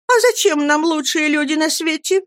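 put-on high, pouty childlike voice; pouting girl. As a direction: pout it out like a spoiled child. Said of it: "надув губки".

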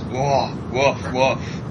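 three barks like a randy dog; mock dog woof. Fancying Francesca in pigtails, playful mock woof. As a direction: woof it out, tongue in cheek.

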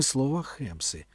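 usual speaking voice

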